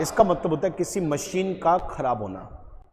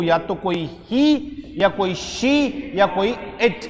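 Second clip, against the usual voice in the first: each word stretched out very slowly; much slower than usual. Shown as a demonstration. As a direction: spell it out for the class. Teacher stretching pronouns. (सर्वनाम धीरे-धीरे खींचकर बोलना)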